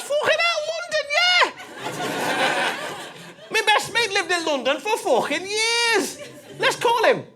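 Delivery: very high, excited Scouse shout; hammed-up Scouse exuberance; Scouse taxi driver. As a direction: squeal with delight as a Scouse cabbie. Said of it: "this Scouse taxi driver".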